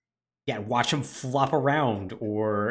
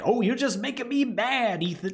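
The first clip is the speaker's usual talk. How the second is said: taunting Jack; 11 semitones higher than usual. Retold or imitated. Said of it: "Jack, like, is just like".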